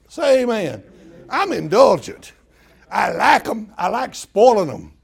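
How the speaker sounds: loud, fired-up declaration; rally the congregation; loud joyful proclamation; preaching loud. Real, not acted. Preaching build, call to congregation.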